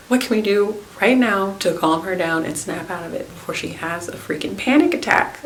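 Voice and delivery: ordinary talk, nothing marked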